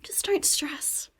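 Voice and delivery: doctor's soft, unvoiced whisper, slow; nonchalant whisper. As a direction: fluister het achteloos weg, zoals de dokter. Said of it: "really nonchalantly go".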